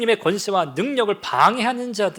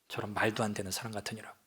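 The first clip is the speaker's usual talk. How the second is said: muttered scoff; 8 semitones lower, 13 dB quieter than usual. Acted. Mourners mocking Jesus.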